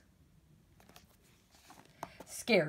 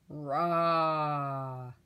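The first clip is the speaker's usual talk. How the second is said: growling "rah" snore; snore roar; 2 semitones lower than usual. Acted. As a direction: let out a rough snoring roar. Norman's snore as a noise.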